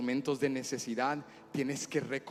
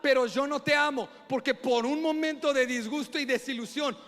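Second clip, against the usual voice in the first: high, forceful reproach; indignant father; 13 semitones higher, 10 dB louder than usual. Retold or imitated. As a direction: re-enact a dad's mounting, emphatic scolding. Hurt father scolds his son. (reproche indignado de padre)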